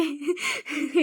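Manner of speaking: a short giggle falling away; quick laugh without words; burst of laughter. Real, not acted. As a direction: let out a real laugh. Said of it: "इतनी हंसी आ रही थी".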